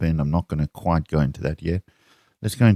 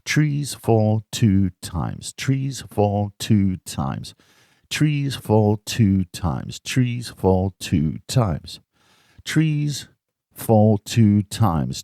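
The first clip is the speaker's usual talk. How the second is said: slow and deliberate; 3 semitones higher, much slower than usual. Shown as a demonstration. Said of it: "Now we're gonna put it on".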